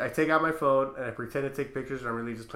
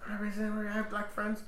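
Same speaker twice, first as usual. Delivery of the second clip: higher, softer imitation of a protester; mock defensiveness; 7 semitones higher, 7 dB quieter than usual. Accused woman excusing herself, mock defensive. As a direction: put on a small defensive voice.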